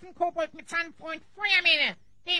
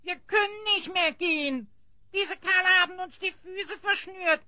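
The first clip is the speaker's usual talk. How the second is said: ordinary talk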